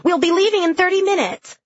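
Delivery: high-pitched call through the house. A narrator's reading. Frida warning Emily they leave soon, calling out. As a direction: call a reminder out, pitched well up. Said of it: "Frida called".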